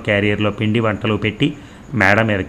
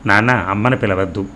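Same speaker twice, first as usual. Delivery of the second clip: ordinary delivery, nothing marked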